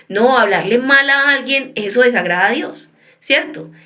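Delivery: usual speaking voice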